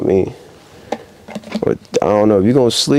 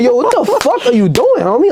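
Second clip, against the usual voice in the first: high, squealed outrage; mock outrage; 13 semitones higher than usual. Scolding a sleeping homie, high squealed outrage. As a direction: squeal it at your homie in disbelief. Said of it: "And I'm just like".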